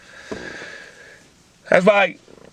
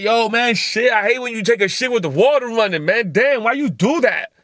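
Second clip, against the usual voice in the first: irritated partner; 5 semitones higher than usual. Retold or imitated. Partner complaining about the running water, mock exasperated. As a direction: whine it like a fed-up partner.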